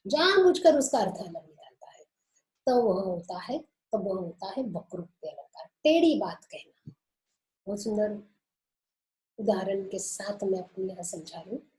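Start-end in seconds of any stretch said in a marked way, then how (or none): none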